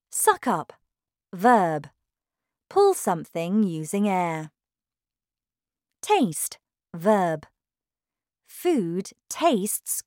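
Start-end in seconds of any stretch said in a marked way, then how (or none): none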